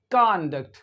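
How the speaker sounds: कॉन्डक्ट with an open a, kahn-dukt; the American aa in कॉन्डक्ट; American conduct. Shown as a demonstration. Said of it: "ए का sound निकलेगा American English में".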